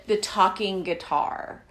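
usual speaking voice

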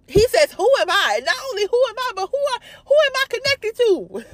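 very high, incredulous preaching cry; impassioned disbelief. Retold or imitated. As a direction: pitch climbs as the question repeats. Preaching David's question with rising fervor.

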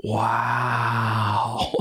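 long, drawn-out amazed "wow"; imitated dumbfounded awe. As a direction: mimic a gullible listener's amazement. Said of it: "they just kinda look at me like".

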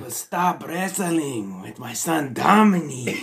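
put-on high, drawn-out cartel-style accent; bad Rey Mysterio accent. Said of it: "Do your Rey Mysterio accent".

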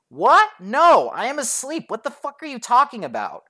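high-pitched incredulous complaint; retold exasperated protest. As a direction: protest being woken, voice shooting up. Irritated reply on second waking.

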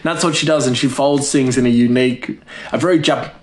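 normal talking voice

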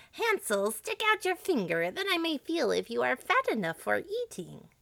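put-on high-pitched old witch voice; wicked witch. A narrator's reading. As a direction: coax the caged boy in a high witchy voice.

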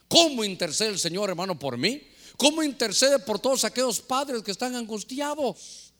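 usual speaking voice

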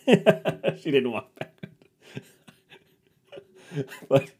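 talking through a real laugh. Real, not acted. Comic admits he made up the backwards walking, laughing.

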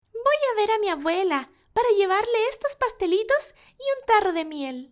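put-on high, bright child voice; little girl voice. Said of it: "le dijo".

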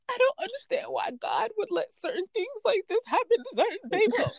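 hammed-up fake sob story; mock crying coworker. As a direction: imitate her weepy lament over God. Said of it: "and was like".

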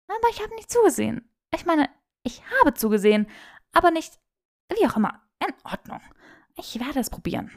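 put-on high, rushed babbling young woman; flustered Hermine. A narrator's reading. Hermine stammers excuses to Draco, high and rushed. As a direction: babble an excuse, then give in.

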